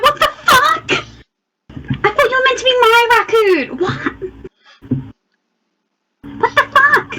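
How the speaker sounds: high-pitched, incredulous protest; acted outrage. Character confronting her disloyal raccoon, high and outraged. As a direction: squeal it in indignant disbelief.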